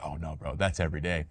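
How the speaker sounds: quiet casual reply. Retold or imitated. Player shrugging off the early uniform question, low and soft.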